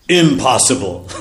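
drawn-out British exclamation; slow, disbelieving British lord; British accent. Retold or imitated. English lord balks at the guru's rules, in a British accent. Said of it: "It is best British accent".

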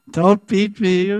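high, slow, mock-frightened plea; pleading Krishna. Krishna begging Lalita, high slow mock plea. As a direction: whine like a scared child.

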